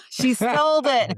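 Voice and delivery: loud, high accusation through laughter; high-pitched playful protest; mock outrage. Teasing accusation about a photo, laughing.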